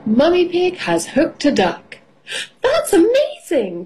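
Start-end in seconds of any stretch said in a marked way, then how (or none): 2.6-3.9 very high, astonished exclamation; amazed cry. A narrator's reading. Miss Rabbit amazed at the hooked duck, high cry. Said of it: "cries Miss Rabbit".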